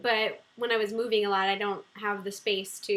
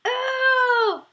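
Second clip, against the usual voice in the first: a sudden, much higher and louder "Oh!"; a startled yelp at something happening off-camera; jumping at something unexpected, with a loud high "Oh!"; surprised cry; 13 semitones higher, 8 dB louder than usual. Real, not acted. Sudden surprise during the livestream.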